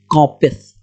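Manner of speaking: usual speaking voice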